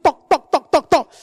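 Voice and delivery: rapid run of high tocks; irritating repeated dripping. Acted. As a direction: imitate a leak that won't stop. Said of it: "It's really irritating".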